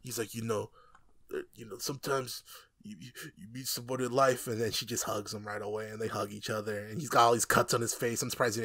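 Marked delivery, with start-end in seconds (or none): 0.3-4.5 choked up. Retold or imitated. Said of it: "you can hear him tear up".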